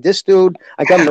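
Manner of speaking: ordinary talk, nothing marked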